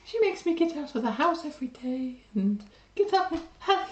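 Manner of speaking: lovey-dovey voice. Shown as a demonstration. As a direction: sigh it out like a smitten lover. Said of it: "that's my lovey-dovey voice".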